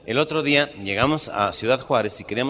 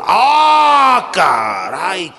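high loud cry of amazement; startled exclamation; 6 semitones higher, 8 dB louder, much slower than usual. Acted. Priest shocked at the sight, loud cry. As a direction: shout out in shock.

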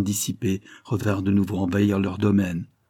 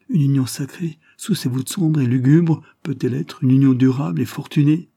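raised, emphatic anxious question; troubled questioning; 5 semitones higher, 5 dB louder than usual. A narrator's reading. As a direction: voice dread at the gloomy omen. Frightened bride doubts the gloomy wedding.

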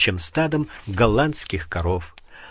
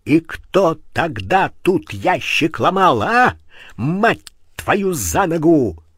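furious yell, higher and louder; uncontrolled rage; 5 semitones higher, 4 dB louder, slower than usual. Acted. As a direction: shout it while shaking him. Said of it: "Уже не владея собой".

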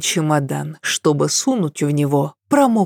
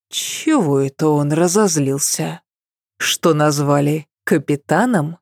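usual speaking voice

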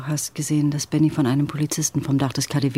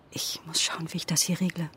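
hushed aside, almost no voice; whisper; 7 dB quieter than usual. Acted. Charlie whispering to the visitor at her door. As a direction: mouth it so the guest won't hear. Guest is in next room.

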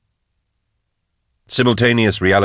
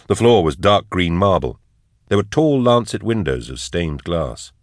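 ordinary delivery, nothing marked

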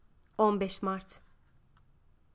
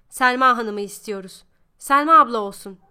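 shouting in unison; 9 dB louder than usual. A narrator's reading. Prisoners shout at the director, loud and united. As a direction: shout the demand together. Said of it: "diye bağırdı herkes bir ağızdan".

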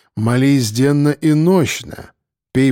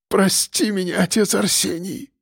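high, soft pleading moan; стон; 6 semitones higher, 3 dB quieter than usual. A narrator's reading. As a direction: moan for forgiveness. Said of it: "простонал он". (жалобный стон)

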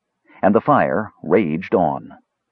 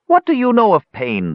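put-on biting, much higher old man's sneer; sarcastic old man; 14 semitones higher, 4 dB louder than usual. A narrator's reading. Old man mocks Tanis about pain, sarcastic. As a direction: scoff at the young one's concern. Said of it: "the old man asked sarcastically".

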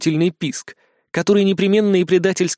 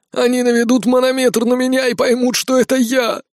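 high, whiny frightened moan; moaning in fear; 8 semitones higher than usual. Acted. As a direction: moan in panic. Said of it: "снова застонал Скиф".

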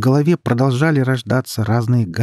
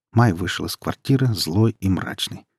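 usual speaking voice

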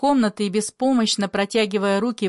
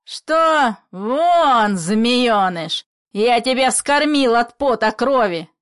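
loud, raised outburst of outrage; furious shouting; 3 semitones higher, 6 dB louder, slower than usual. Acted. Father orders his foster son out, furious shouting. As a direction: throw him out at full volume.

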